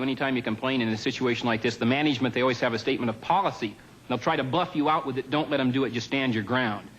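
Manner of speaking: ordinary talk, nothing marked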